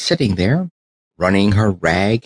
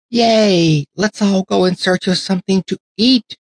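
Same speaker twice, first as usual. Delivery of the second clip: much higher, squealing cheer of many dolls; excited cheer; 11 semitones higher than usual. Acted. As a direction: whoop it back as a happy crowd. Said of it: "cried all the other dollies".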